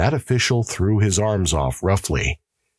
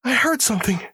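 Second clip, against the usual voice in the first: much higher, desperate begging; terrified pleading; faster than usual. Acted. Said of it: "said the prisoner".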